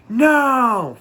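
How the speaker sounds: loud, high, drawn-out mock roar; imitated crowd shout. Audience answers the singer's haircut question, imitated crowd shout. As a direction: yell it back like a whole audience. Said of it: "And the audience went".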